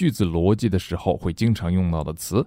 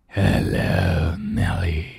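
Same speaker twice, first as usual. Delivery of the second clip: much lower, menacing monster hello; deep scary voice; 2 semitones lower, much slower than usual. Shown as a demonstration. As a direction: drop low and growl like a monster. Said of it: "又低沉又恐怖的声音".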